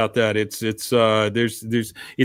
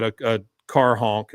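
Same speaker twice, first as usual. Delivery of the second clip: ordinary delivery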